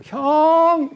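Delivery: 높고 크게 형을 부르는 외침; 멀리 있는 형들을 반갑게 부르듯 크게; calling out. Acted. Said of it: "하고 소리 지르니까". (형들을 향해 높고 크게 외치는 요셉)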